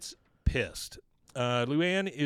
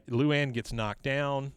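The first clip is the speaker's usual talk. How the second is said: ordinary talk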